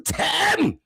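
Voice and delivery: very high mock call; imitated calling out. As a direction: send the name way up high. Acts out Michelle calling Tim.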